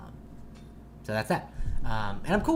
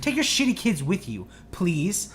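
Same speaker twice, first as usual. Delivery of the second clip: loud, high, defiant comeback; retold screaming tirade; 7 semitones higher, 5 dB louder, slower than usual. Teen orders the mom out of the party, yelling. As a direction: throw the order straight back, re-enacted. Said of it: "screaming at the top of my lungs".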